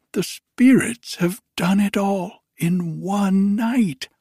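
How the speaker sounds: put-on high, loud, elated old miser; giddy old Scrooge. Scrooge overjoyed on Christmas morning, high giddy voice. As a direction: bubble over with joy as old Scrooge.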